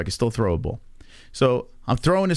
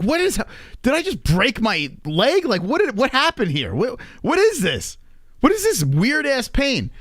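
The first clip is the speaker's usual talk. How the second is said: panicked disbelief; 8 semitones higher than usual. Retold or imitated. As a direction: ask it panicking over the pain. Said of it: "I'm like".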